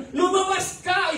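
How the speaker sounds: high, strained command to get out; eerie high-pitched possessed voice; possessed mother's voice. Retold or imitated. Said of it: "Iba yung salita ng mama ko noon".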